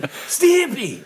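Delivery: very high cartoon exclamation; high-pitched cartoon outburst; Ren. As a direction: cry the name like a manic cartoon chihuahua. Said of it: "I can do Ren".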